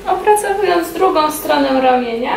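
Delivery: ordinary delivery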